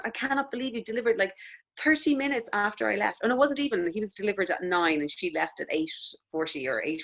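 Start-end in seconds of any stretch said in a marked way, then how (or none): 0.1-3.2 lightly voiced midwife, higher and amazed; a hint of the Italian midwife, marvelling; imitating the midwife. Midwife on the ward marvels at the quick delivery, lightly mimicked.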